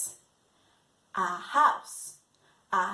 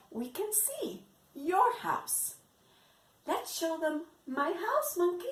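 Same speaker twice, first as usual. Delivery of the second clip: ordinary talk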